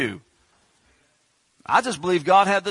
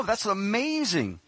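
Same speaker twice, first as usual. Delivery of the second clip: ordinary talk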